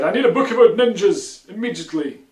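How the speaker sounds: put-on loud, high, eager kid demanding a book; a loud, urgent young kid's plea; excited child insisting loudly.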